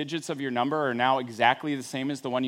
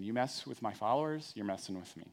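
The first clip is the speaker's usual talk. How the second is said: quiet firm warning; 5 semitones lower, 10 dB quieter than usual. Acted. Jesus's meaning restated softly.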